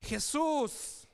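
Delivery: crying out. Shown as a demonstration. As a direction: call the name out loud. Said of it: "clamó a gran voz".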